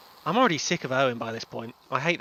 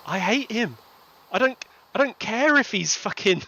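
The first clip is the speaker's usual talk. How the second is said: higher-pitched, worked-up venting; exasperated rant; 8 semitones higher, 5 dB louder than usual. Real, not acted. Fan venting about hated character Owen, exasperated rant. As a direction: vent it with rising exasperation.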